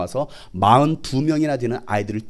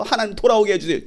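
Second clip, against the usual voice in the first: mock prayer; 7 semitones higher, faster than usual. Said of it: "차라리 암에 걸려서".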